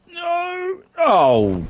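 high panicked cry; panicking gamer. Real, not acted. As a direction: yelp in sudden panic. Neighbour catches him, panic.

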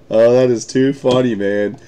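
usual speaking voice

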